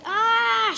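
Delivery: loud high scream of shock; mom's scream. A narrator's reading. Mom shrieks at the ruined flowers, loud and high. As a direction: shriek at the dug-up flower bed. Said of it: "she screamed".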